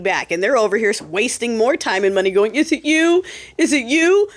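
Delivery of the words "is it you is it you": mock-paranoid "is it you?"; mock paranoia. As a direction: parody frantic leak hunters. Mocking paranoid leak hunters.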